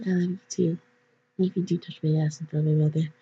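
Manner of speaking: soft, fast, near-silent aside; whisper. A narrator's reading. Jack whispering to Mark after the kiss, softly. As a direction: breathe it close to his ear. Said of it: "and whisper".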